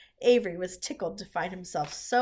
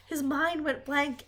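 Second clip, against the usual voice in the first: usual speaking voice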